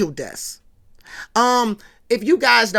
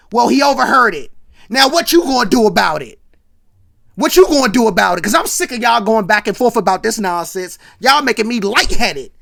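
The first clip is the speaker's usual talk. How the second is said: loud, fed-up scolding; mad mama; 7 dB louder than usual. Retold or imitated. Big Mama jumps in on the boys' back and forth, mad. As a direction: imitate an angry mother laying into the kids at full volume. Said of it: "and she's mad".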